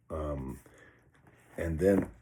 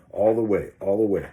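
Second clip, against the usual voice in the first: louder, driving repeated chant; defiant shout; 2 semitones higher, 8 dB louder than usual. Retold or imitated. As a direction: push through the fear out loud. Chanting through the fear.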